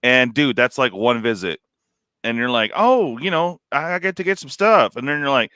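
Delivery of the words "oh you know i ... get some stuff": higher, bright mock happy shopper voice; mock cheerful shopper. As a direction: imitate a carefree shopper going all in.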